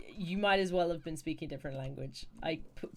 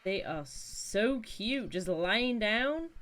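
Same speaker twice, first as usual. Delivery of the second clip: slow, higher cooing at sleepy animals; 5 semitones higher, 5 dB louder, much slower than usual. Real, not acted. Streamer cooing at animals lying down, slow and higher.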